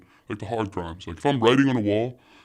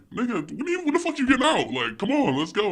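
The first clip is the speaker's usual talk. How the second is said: high-pitched, loud pleading protest; exasperated retort; 6 dB louder than usual. Retold or imitated. Child urging jailed dad to get out, exasperated. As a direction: urge him on in mock exasperation.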